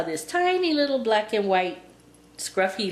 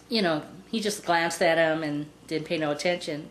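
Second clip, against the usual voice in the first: usual speaking voice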